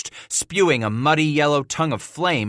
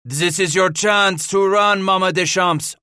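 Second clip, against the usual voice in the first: high, raised-voice warning; calling out; 8 semitones higher, 5 dB louder than usual. A narrator's reading. Henri offers the matriarch a last chance, calling out. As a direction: call the offer across to her. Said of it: "Henri explained".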